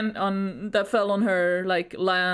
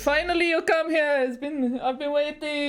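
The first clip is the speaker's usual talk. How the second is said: mock Russian accent; 5 semitones higher than usual. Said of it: "be like".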